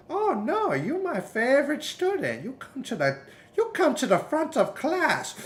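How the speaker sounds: hammed-up master doting on his favorite; karate master. The karate master praising his favorite student in a high voice.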